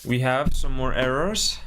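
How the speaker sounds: ordinary talk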